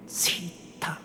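whispered, drawn-out order to be silent; urgent shush. A narrator's reading. Priest hushing the women in dangerous territory, urgent whisper. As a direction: cut her off in an alarmed whisper.

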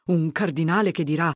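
rising, exasperated self-pitying rant; agitated fretting. A narrator's reading. Curate alone dreads the whole mess renewed, exasperated.

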